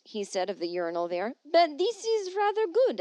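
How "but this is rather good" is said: quoting voice. Retold or imitated. Quoting Parisian man.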